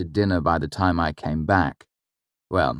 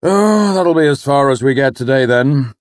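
an irritated, louder father's grumble; grumbling; 8 semitones higher, 8 dB louder than usual. Acted. The father grumbling at yet another tree on the road. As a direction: sigh it out, annoyed. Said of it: "he grumbled".

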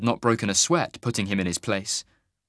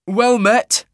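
higher, louder voice for Cole greeting a stranger; a bold traveller hailing a wagon master; Cole's voice; 12 semitones higher, 8 dB louder than usual. Acted. Narrator voicing Cole hailing the teamster, brisk and loud.

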